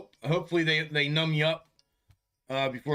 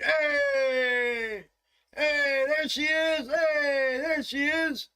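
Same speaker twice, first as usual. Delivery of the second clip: much higher, calling out welcome to her; excited greeting; 12 semitones higher, much slower than usual. Retold or imitated. Everybody greeting the new member walking in, excited high calls. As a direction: cheer her arrival like a happy crowd. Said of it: "everybody's like".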